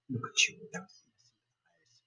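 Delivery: hushed and breathy, cruel; menacing whisper. A narrator's reading. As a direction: whisper it like a threat. Villain taunts girl.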